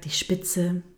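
ordinary delivery

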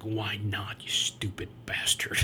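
hushed menacing whisper. Retold or imitated. Patrick hissing at his attorney, menacing whisper.

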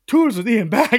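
high, loud disbelieving question; acted-out startled realisation; incredulous echo. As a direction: blurt it back in alarmed disbelief. Echoes host's intro in disbelief.

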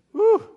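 high, loud exhaled whew; acted sigh of relief, pitched high. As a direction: let out a big relieved whoop of air. Acts relief when the noise stops.

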